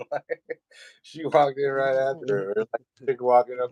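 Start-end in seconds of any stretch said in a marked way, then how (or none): none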